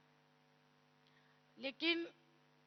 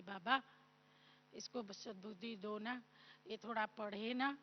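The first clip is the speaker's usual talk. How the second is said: hushed pleading prayer; 3 semitones lower, 8 dB quieter than usual. Retold or imitated. Mother pleads with Baba again.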